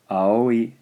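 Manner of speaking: aoi said as one flowing word; whole word. Shown as a demonstration. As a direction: join the vowels into aoi. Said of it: "First syllable".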